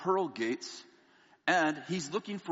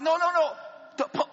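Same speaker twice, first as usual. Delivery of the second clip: much higher and louder frantic protest; 13 semitones higher, 5 dB louder than usual. Retold or imitated. Protesting to the luggage lady, frantic shout. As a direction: cut her off in a panic. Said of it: "I'm like".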